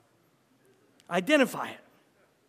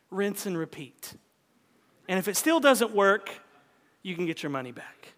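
usual speaking voice

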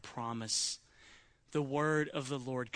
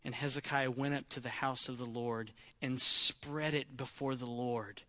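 ordinary delivery

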